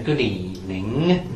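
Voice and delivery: slow nasal Snide voice. Retold or imitated. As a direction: pinch it through the nose. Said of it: "spoke with a very nasal".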